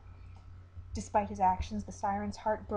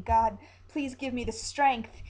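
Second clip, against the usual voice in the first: slow, louder desperate cry to God; shouting; 3 semitones higher, 7 dB louder, slower than usual. Acted. Captain praying before pulling the gun, desperate shout. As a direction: call up to heaven for strength. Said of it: "he shouts".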